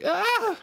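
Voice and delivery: sudden high shriek; startled high yelp; mock scream. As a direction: shriek as the spider leaps at you. Mock scream at jumping spider.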